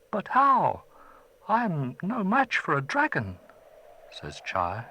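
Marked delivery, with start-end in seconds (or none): 0.1-3.4 higher, louder anxious protest; a scared child pleading; frightened boy. Acted. Chai fearing the dragon, protesting to the old man.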